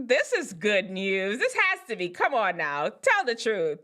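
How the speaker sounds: usual speaking voice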